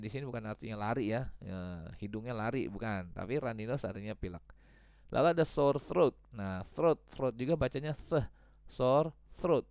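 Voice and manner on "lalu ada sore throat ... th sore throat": ordinary talk, nothing marked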